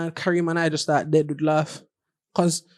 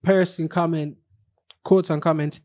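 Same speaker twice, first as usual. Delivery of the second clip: usual speaking voice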